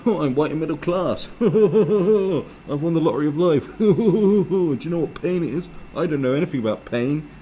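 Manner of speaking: high, loud hooting laughs between boasts; braying self-satisfied parody voice; mock middle-class toff. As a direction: guffaw smugly through a hammed-up privileged twit. Mocks a middle-class comic, guffawing.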